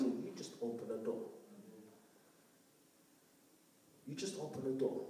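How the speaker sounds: low and hushed, letting it land; soft, sobering. Real, not acted. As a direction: drop the voice right down on the point. Sobering point, repeated softly.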